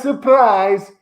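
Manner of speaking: normal talking voice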